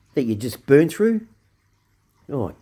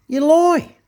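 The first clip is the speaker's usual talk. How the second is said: much higher, loud "you lie"; shouted accusation; 6 dB louder than usual. Real, not acted. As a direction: yell it at the government. Yelling at the government.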